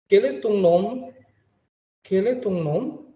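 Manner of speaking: "Quel est ton nom?" high, rising at the end; rising question. Shown as a demonstration. Teacher models the informal question, high and rising. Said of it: "itu kita gunakan ton".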